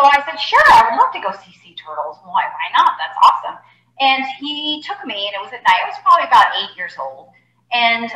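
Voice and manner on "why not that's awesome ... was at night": usual speaking voice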